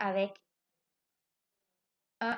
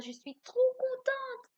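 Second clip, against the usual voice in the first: high, excited, drawn out; trop contente; 13 semitones higher, slower than usual. Shown as a demonstration. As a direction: show the joy in it, up high. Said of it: "si vous êtes trop contente". (voix aiguë et joyeuse, ralentie)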